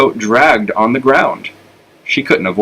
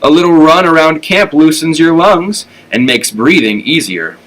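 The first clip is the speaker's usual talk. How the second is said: raised, higher and louder to be heard; 6 semitones higher, 3 dB louder than usual. A narrator's reading. Flora speaking up to the ignoring dogs, loud and high. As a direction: call it out so the room must hear. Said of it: "she said in a louder voice".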